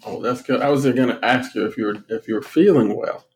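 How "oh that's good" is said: ordinary delivery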